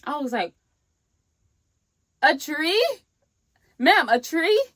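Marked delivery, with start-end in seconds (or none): 2.2-4.6 high, loud incredulous question; retold disbelief. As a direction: question it in mock astonishment. Retells disbelief at aunt's tree.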